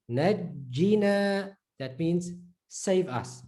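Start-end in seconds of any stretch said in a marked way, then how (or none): 0.1-1.5 Najjina, slow, the jj held; held doubled jim. Shown as a demonstration. Teacher drilling the doubled j slowly. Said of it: "the emphasis aspect is very important".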